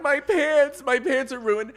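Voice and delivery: high, loud despairing cry; loud, high wail about ruined pants; wailing yell. Retold or imitated. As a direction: howl it over the ruined pants. Retold screaming at husband.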